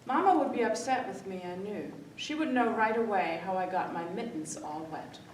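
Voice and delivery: usual speaking voice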